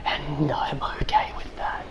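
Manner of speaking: slow, drawn-out whisper; whispered aside. Pretend. Gamer telling viewers they're okay with that, in a slow whisper. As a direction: whisper it slowly, savouring each word. Creepy aside after boss kill.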